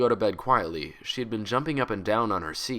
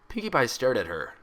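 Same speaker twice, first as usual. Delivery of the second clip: plain reading voice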